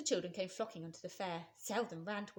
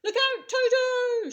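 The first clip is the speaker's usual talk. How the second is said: much higher, louder, slow warning shout; 16 dB louder, much slower than usual. Acted. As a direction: hippo yelling a warning as he charges. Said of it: "shouted Humpy Rumpy".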